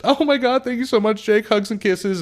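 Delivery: very high gushing thanks; gushing mock thanks. Customer thanks the mechanic, high gushing mock. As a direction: gush it out high and sweet.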